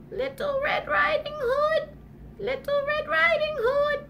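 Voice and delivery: high, soft far-off call; muffled high grandma cry. Acted. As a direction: call in a small falsetto from hiding. Grandma calls from the closet.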